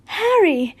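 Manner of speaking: high, loud joyful call of a name; excited call. Acted. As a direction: cry out gladly as Tonks. Tonks greets Harry joyfully.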